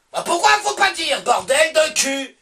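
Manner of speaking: high indignant yell, slowing at the end; indignant outburst. Real, not acted. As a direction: protest loudly, slowing on the curse.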